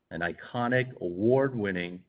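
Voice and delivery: ordinary talk, nothing marked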